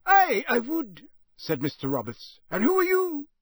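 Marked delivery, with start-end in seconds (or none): 0.1-1.0 high, loud country voice; a wary old Scots countryman; rustic campsite owner. Acted. 2.5-3.3 high, loud country voice; a wary old Scots countryman; rustic campsite owner. Acted.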